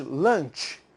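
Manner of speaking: slow English pronunciation of lunch; teacher modelling English lunch. Shown as a demonstration. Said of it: "na língua original do inglês".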